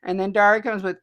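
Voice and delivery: ordinary delivery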